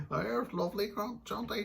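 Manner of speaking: high-pitched put-on niceness; pretend nice. Old housemate recalling fake politeness, pretend nice. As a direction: play overly nice, voice pitched right up. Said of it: "pretend that we were nice".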